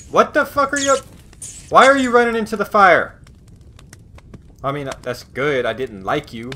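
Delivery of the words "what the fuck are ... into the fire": high, loud startled outburst; a shocked yell at a fire-runner. Real, not acted. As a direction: blurt it out in alarm. Startled by character running into fire.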